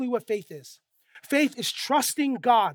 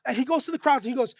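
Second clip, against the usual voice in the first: ordinary delivery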